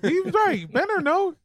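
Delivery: mock outrage. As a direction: fake indignation, way up high.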